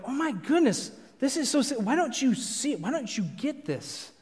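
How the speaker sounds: high-pitched, mild mock frustration; exasperated anger. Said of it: "like, anger".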